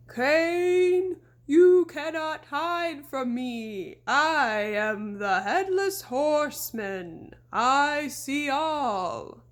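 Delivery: slow, loud menacing phantom voice; ghostly horseman. A narrator's reading. Girl as the Headless Horseman taunting the deputy, slow and ghostly. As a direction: loom over him like a spirit.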